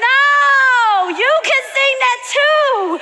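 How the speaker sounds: high, loud call to the congregation; exuberant urging. Real, not acted. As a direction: cry out to get them singing. Urging congregation to sing along.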